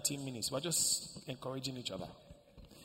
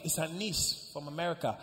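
ordinary talk

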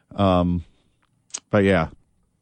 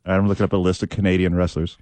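usual speaking voice

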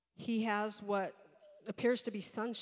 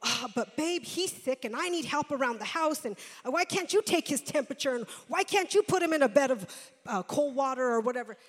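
frustrated wife; 2 semitones higher, 8 dB louder than usual. Retold or imitated. Mother complains to husband about sick child, mock frustrated. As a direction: whine it like a tired wife. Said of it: "Don't get frustrated and say".

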